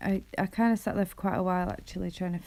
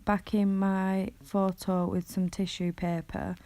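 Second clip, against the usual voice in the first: usual speaking voice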